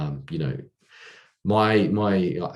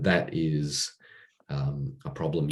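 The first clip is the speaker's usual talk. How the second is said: normal talking voice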